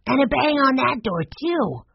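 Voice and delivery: put-on high child's add-on; high-pitched boyish report; indignant boy. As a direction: add the detail as a worked-up little boy. Boy adds indignant detail.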